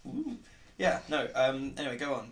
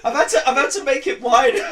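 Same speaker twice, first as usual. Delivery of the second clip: loud laugh through the words; cracking up mid-sentence; laughing hard; 10 semitones higher, 10 dB louder than usual. Real, not acted.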